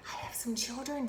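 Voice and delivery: hushed teacher whisper; softly whispered classroom intro. Shown as a demonstration. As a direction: whisper it to draw the children in. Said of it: "I purposely whisper during this".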